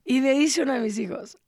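ordinary talk, nothing marked

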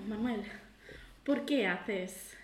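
ordinary talk, nothing marked

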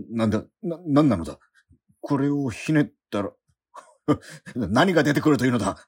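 half-laughing alarm at the faucet; panicked. Acted. Man panics as the faucet sticks to his chest.

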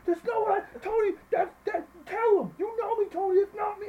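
very high, loud mock protest; frantic coach defending himself. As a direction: imitate a flustered coach backpedaling at full volume. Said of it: "he's, like, defending himself".

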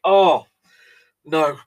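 high, loud, hammed-up despair; mock anguish. Host pretending tea horror overwhelms him, mock anguish. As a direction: wail like it's a tragedy. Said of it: "I'm gonna have to take a moment".

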